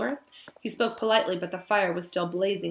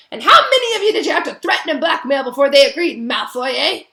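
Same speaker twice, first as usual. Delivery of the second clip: much louder, higher angry roar; 6 semitones higher, 12 dB louder than usual. A narrator's reading. Hagrid accusing Malfoy of blackmail, roaring. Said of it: "he roared".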